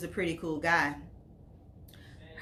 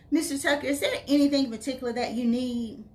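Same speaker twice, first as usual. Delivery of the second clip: high, lilting question; mock-proper phone query, pitched up; playfully formal; 6 semitones higher, 5 dB louder, faster than usual. A narrator's reading. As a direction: ask it in a raised, teasing lilt.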